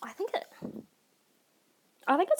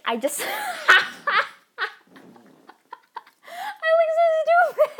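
high, loud laughing through the words; 10 dB louder than usual. Real, not acted. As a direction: crack up at yourself. Laughing at own attempt.

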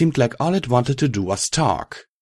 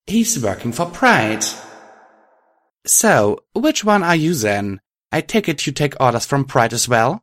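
put-on young hero, pitched up; raised hero voice; 6 semitones higher than usual. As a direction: voice the hero a bit higher. Narrator voices hero, higher.

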